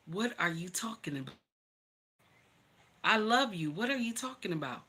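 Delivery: hushed and tender, calming her fears; soft, gentle reassurance. Retold or imitated. Husband softly reassuring his scared pregnant wife.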